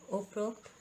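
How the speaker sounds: उपरोक्त said as "oprokt"; wrong form. Shown as a demonstration. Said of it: "नहीं होता".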